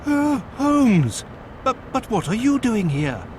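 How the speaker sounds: high startled gasp of the name; stunned to find his friend there; astonished cry. Acted. Watson shocked to see Holmes.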